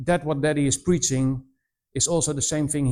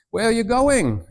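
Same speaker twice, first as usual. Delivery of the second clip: imitated distant shout; 7 semitones higher, 3 dB louder than usual. Villager shouting from the other side of the valley, mock shout. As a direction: call out as if to the far hillside. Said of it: "I was shouting".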